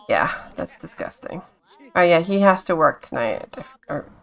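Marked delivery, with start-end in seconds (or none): 0.1-1.4 soft, breathy disgusted whisper; whispered disgust, much softer. Real, not acted. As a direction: murmur it with a grimace. Dog scene still.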